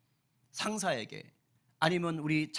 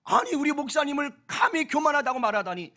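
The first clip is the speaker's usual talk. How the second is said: higher, louder, agitated protest; indignant staff; 8 semitones higher, 7 dB louder than usual. Retold or imitated. Staff protesting to Moody about the newspaper, agitated. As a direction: act out outraged helpers complaining. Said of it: "하면서 막 난리가 난 거예요".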